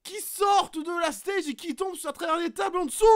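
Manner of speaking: play-by-play pitched high; commentaire de catch. Acted. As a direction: raconte la chute comme un commentateur. Commentary of the match. (commentateur emballé)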